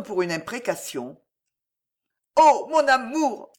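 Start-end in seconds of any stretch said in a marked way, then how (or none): 2.4-3.5 high, loud, impassioned outcry; growled passion. A narrator's reading. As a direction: cry out wildly to the absent lover. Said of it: "gronda-t-elle". (cri passionné et farouche)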